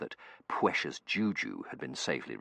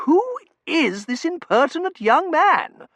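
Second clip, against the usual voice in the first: haughty lady voice; 12 dB louder than usual. Acted. Mrs Meering character voice.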